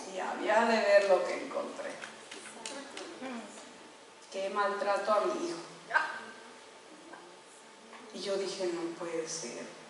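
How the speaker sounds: low, very soft, with heavy pauses; hushed disbelief. Retold or imitated. Mother recalls finding her son mistreated, hushed and stunned. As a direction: let the voice sink away in shock. Recounting discovery of son's mistreatment.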